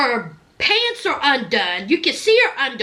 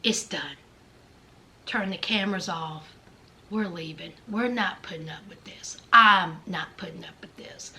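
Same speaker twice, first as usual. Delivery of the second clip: lower, much softer firmness; quiet firm whisper; 8 semitones lower, 7 dB quieter than usual. Pretend. Son quietly telling his mother they are leaving, hushed. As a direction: quietly shut it all down. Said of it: "look at his mother and say".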